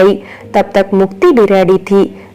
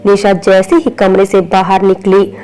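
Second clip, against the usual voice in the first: plain reading voice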